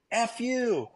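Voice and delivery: high-pitched mock yell; retold cursing. Handler cursing back at the bitten man, high mock yell. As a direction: throw the curse back, imitating a shouting match. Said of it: "we're just going back and forth".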